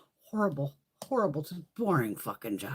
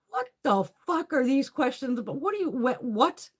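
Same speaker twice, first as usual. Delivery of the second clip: incredulous; 9 semitones higher, 4 dB louder than usual. Retold or imitated. Her baffled reply retold.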